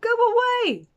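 very high, loud shout; loud shrill go away; angry dismissal. A narrator's reading. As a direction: shout him out of the circus. Ringmaster chasing him off.

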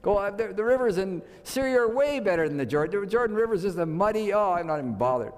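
high-pitched, sputtering mock indignation; acted scorn, pitch dropping away at the end; mad. Naaman scorns washing in the Jordan, mad. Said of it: "He was mad".

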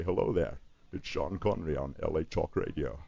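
a hint of Connery's slushy S as SH; Connery-style Scottish lilt, lightly done; Sean Connery impression. Shown as a demonstration. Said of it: "you're starting to talk like this".

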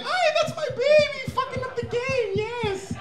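proud parent. Retold or imitated. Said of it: "then you're like".

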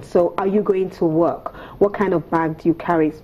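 normal talking voice

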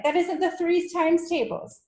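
high-pitched schoolboy protest; Wilfred. A narrator's reading. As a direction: cry out that it is unfair. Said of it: "Wilfred cried".

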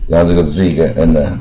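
usual speaking voice

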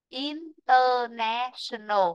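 "International" very slow, each syllable separate; slow counting. Shown as a demonstration. Teacher counts the syllables with the class, slow. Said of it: "Chúng ta đếm nhá".

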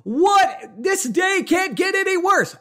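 a high, angry retold outburst at a ruined day; retold yell. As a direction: shout it out, fed up with the day. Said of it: "I'm just yelling".

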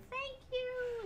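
very high, soft, airy spirit voice; high voice of departing spirits; ghostly thanks. Acted. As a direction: float a tiny ghostly thank you. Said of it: "you hear".

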